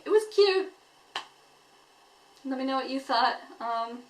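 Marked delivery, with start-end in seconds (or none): 0.0-0.7 mock cutesy. Cooing over the episode.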